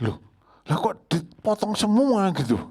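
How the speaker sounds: high, loud and slow "lah kok" of disbelief; loud startled dismay; shocked outburst. Retold or imitated. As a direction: cry out at the sight of the bare branches. Shocked at the cut-down plant.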